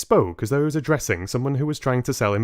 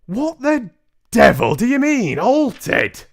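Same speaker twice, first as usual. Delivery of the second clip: loud, higher, incredulous shout; 11 dB louder than usual. Acted. Father shocked by the news about his son, loud shout. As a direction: bellow the word in disbelief. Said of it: "shouted Mister Jenkins".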